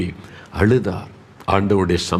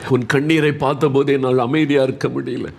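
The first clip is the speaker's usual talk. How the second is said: pleading, higher-pitched voice with long choked pauses; overcome with compassion; 6 semitones higher than usual. Acted. God to weeping Hezekiah, overcome with compassion. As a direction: speak as one deeply moved by tears.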